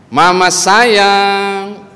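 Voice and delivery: very slow, loud, high call of Mama sayang; drawn-out loud call. Retold or imitated. Husband calls his wife from the living room, drawn out loud. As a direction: stretch the call out across the room. Calling wife through the house.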